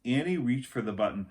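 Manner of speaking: usual speaking voice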